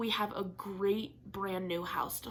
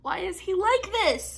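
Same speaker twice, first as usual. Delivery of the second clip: much higher and louder, amused disbelief; exasperated laughing question; 12 semitones higher, 8 dB louder than usual. Real, not acted. Asking in amused despair about her cat. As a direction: throw it up in mock despair.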